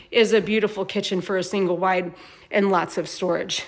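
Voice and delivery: ordinary talk, nothing marked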